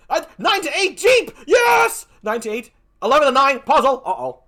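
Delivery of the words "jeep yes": excited cheer. Real, not acted. His side gains in the vote.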